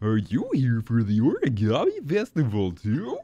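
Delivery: swooping cartoon creature voice; put-on game creature voice; minion, curious. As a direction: ask as an eager cartoon henchman. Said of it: "They all sound the same".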